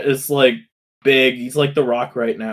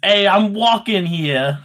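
put-on New York accent; 5 semitones higher than usual. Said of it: "exactly how Captain Lou Albano voiced Mario".

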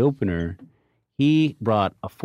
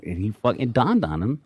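normal talking voice

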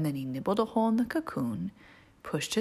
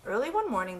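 plain reading voice

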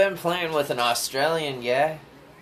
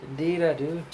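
put-on Irish accent; 2 semitones higher than usual. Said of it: "I feel like more Irish comes out".

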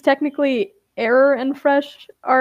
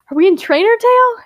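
much higher and louder, quick excited question; 11 semitones higher, 6 dB louder, faster than usual. Acted. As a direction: blurt it out, thrilled at the idea. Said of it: "She kinda gets excited".

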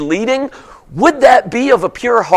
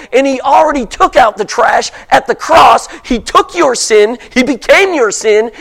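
ordinary talk, nothing marked

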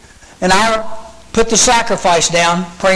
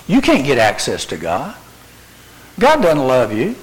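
devil's whisper; 5 semitones lower than usual. Acted. Preacher voicing the devil's lies.

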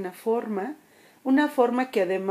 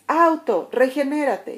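higher, louder cheerful order; happy command; 5 semitones higher, 5 dB louder than usual. Retold or imitated. Happy passer-by telling the car to regenerate, bright and higher. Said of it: "llega otra persona feliz".

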